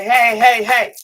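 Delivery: angels tapping your shoulder, urging you out; angels calling out. Pretend. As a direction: spirit guides loudly calling to get out. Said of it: "They tap you on the shoulder like".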